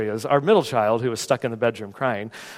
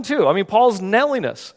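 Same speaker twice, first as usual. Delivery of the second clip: ordinary talk, nothing marked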